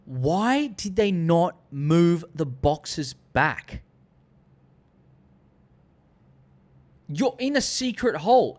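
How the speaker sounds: high, loud, disbelieving complaint; incredulous fan ranting; exasperated rant. Real, not acted. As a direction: vent about it like it drives you mad. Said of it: "the one thing that pissed me off".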